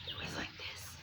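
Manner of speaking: eerie, voiceless whisper imitating a demon; demonic whisper. Storyteller imitating a weird voice heard in the haunted house, demonic whisper. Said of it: "some weird, like, kind of demonic kind of sound".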